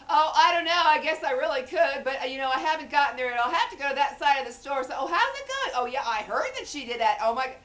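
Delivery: high, very loud mock chatter; loud cell-phone talker. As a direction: blare an oblivious phone conversation. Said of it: "that loud voice".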